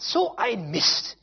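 loud, high, exasperated grumbling at himself; a man fretting over money he does not have, loud and upset; retold frustrated self-talk. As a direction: vent the frustration like he is pacing the house. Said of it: "hab gesagt".

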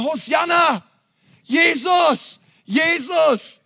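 high, mock crowd shout; crowd cheering. Preacher voices the whole city greeting Jesus, high cheering calls. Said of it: "sie alle haben gerufen".

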